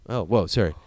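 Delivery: ordinary delivery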